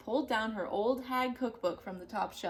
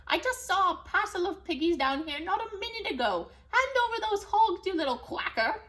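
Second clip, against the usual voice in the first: loud, high furious screech; screeching witch; 9 semitones higher, 6 dB louder than usual. Acted. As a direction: scream into the bill. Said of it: "scritched into his bill".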